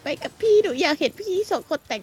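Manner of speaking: put-on sweet girl voice begging to come along; girl character voice. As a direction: plead as the little sister. Voices girl Amita pleading.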